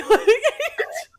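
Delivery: sudden high loud laugh; real amused laugh, high and loud; high burst of laughter. Real, not acted. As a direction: let a squealing laugh burst out. Laughing at own story.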